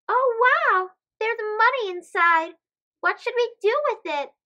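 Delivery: excited wow. Acted. Kids discover money.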